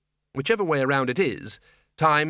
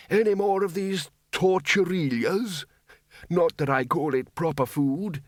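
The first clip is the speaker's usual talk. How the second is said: a lightly voiced, higher old man grumbling about food; a doddery old wizard, a little higher, mildly fussy; rambling old wizard; 5 semitones higher than usual. A narrator's reading.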